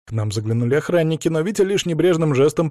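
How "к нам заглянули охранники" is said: plain reading voice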